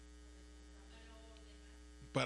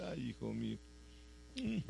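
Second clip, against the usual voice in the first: sorrowful father; 7 dB quieter than usual. Retold or imitated. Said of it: "se te queda mirando el Señor y dice".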